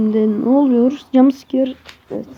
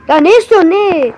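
exasperated shout; 5 semitones higher, 7 dB louder than usual. Acted. Annoyed at in-game baby.

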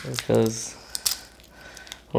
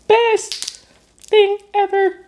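excited; 4 dB louder, much slower than usual. Real, not acted. Delighted by the food.

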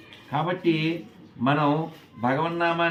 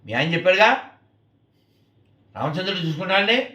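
higher, louder angry retort; 5 semitones higher, 5 dB louder, much faster than usual. Retold or imitated. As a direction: throw it back crossly, acting out an angry son. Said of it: "ఆయన చాలా కోపంగా". (చాలా కోపంగా)

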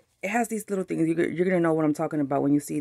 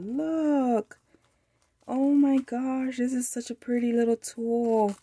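delighted coo; 6 semitones higher than usual. Real, not acted. Showing off a tulle pouch.

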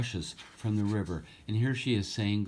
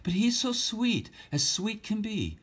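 much higher, doting princess voice; sighing princess; 13 semitones higher than usual. Acted. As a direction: coo over the sweet baby. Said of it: "the princess sighed".

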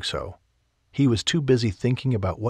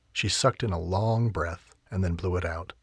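usual speaking voice

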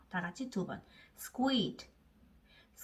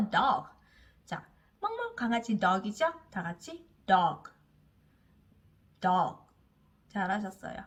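normal talking voice

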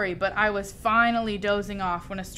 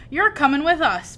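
higher, louder pirate command; gruff pirate order; 6 semitones higher, 6 dB louder than usual. A narrator's reading. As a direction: tell him he's coming along. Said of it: "Braidbeard told me".

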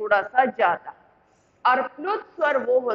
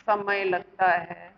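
dictation; 5 semitones lower, 5 dB quieter than usual. Shown as a demonstration. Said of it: "पहले इसकी परिभाषा लिख लो".

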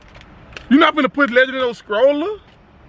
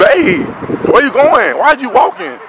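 louder calling after her; 4 semitones higher, 5 dB louder than usual. Real, not acted. Calling after his partner as she walks away. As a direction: call out to her down the street.

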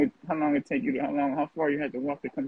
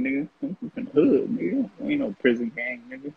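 ordinary delivery

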